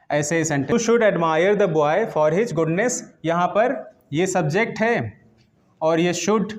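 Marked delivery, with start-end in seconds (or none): 0.9-2.9 slow dictation. Shown as a demonstration.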